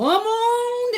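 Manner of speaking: high, slow, stretched "come on"; drawn-out calling invitation. Pretend. Streamer inviting Jorge to visit, high drawn-out call. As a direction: sing it out high like a big invitation. Game-show style invitation.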